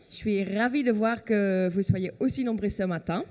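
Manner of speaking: ordinary talk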